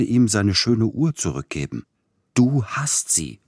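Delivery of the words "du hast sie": slow, dogged accusation pitched up; stubborn insistence. Acted. Feverish patient accusing the servant, stubbornly insistent. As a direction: insist he has it, feverish and sure. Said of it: "behauptete er hartnäckig".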